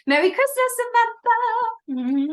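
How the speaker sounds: high, loud festive sign-off; festive singsong. Real, not acted. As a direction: sing out a holiday farewell.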